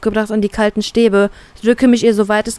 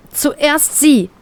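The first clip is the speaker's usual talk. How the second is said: higher, sharp insistence; gereizte Forderung; 6 semitones higher than usual. Acted. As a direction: demand the girl go first. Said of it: "fordere ich gereizt". (gereizt und bestimmt)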